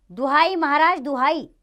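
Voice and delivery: loud, high cry of appeal; crying out for justice. Acted. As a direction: call out to the king for redress. दुहाई शब्द उद्घोषित होता है.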